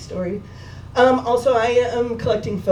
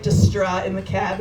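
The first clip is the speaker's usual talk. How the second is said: ordinary delivery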